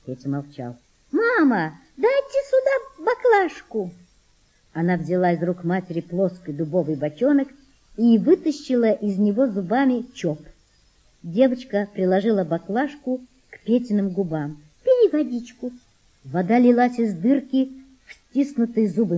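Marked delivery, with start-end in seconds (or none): 1.1-3.9 put-on high girl voice calling out; a young girl calling across the boat; girl calling mother. Girl asks mother for the flask, high calling voice. 14.8-15.7 put-on girl's voice. Girl gives the boy water.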